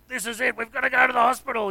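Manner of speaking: mock urgency, pushing to get going; imitating urgent wife. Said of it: "squeezing my hand really tight one day and said".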